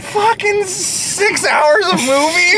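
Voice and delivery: high, slow, crying shout of protest; mock outrage. Friend wailing in mock despair about a six-hour movie. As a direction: wail it like it's torture.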